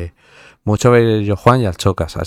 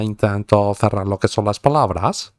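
usual speaking voice

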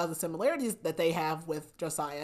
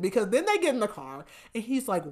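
ordinary talk

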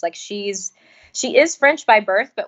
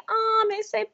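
high-pitched cooing in French; cooing French admirers; 12 semitones higher than usual. Retold or imitated. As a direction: gush over it as if adorably cute. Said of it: "tout le français dit".

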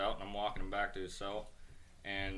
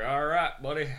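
Banjo's gruff order; 6 semitones higher, 9 dB louder than usual. Retold or imitated.

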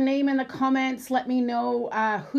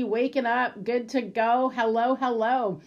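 usual speaking voice

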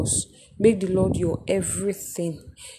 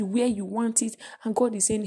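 ordinary talk

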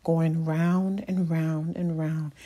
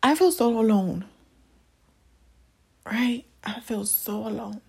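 tearful; 4 semitones higher than usual. Real, not acted. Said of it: "Okay, I'm not crying".

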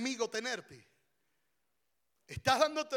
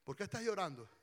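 soft, caring question to a crying wife; gentle concern; 10 dB quieter, much faster than usual. Acted. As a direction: ask quietly, offering comfort. Caring husband consoles crying pastora.

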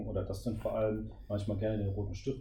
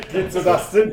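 much higher, much louder laughter; big laugh; 9 semitones higher, 16 dB louder than usual. Real, not acted. Host cracks up at the erotic-script joke, laughing hard. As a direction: burst out laughing.

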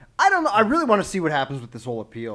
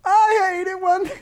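mimicking a friend's high, slow impression; way too high; 4 dB louder, slower than usual. Host mocking his friend's impression, way too high. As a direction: drag it out absurdly high-pitched. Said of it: "that's just like you".

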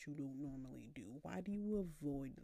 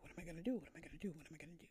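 fading panic; 5 dB quieter, much faster than usual. Retold or imitated.

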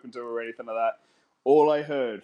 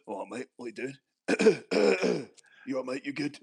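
lightly put-on Cockney lad, slow and casual; 4 dB quieter than usual. Friend mimics Jack turning back to him after the call, Cockney voice. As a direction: imitate a mate switching back to blokey Cockney. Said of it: "Is he Cockney".

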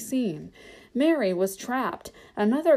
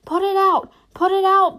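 very high, loud desperate plea; begging cry; 10 semitones higher, 8 dB louder than usual. A narrator's reading. Trapped hobbit begging for the fire out, frantic cry. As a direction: beg at a yell, frantic. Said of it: "begged Mary".

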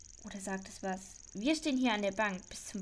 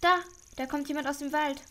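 higher, louder sudden alarm; 5 semitones higher, 6 dB louder than usual. Acted. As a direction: blurt it out as you spot someone. Spots someone coming from the forest. (aufgeregt, plötzlich lauter)

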